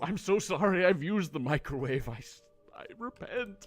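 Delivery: pleading, rising confession; mock penitent. Player confessing microwave use to friar, hammed-up pleading. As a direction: beg forgiveness theatrically.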